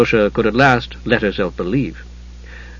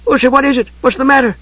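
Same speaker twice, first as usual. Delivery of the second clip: high, worried questioning; alarmed; 12 semitones higher, faster than usual. A narrator's reading. Tas questioning pale Usha, alarmed. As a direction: ask urgently, pitched way up. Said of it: "Tas was alarmed".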